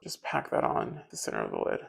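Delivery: whispered to herself. Real, not acted. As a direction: breathe it out as a whisper.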